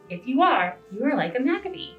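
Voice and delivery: plain reading voice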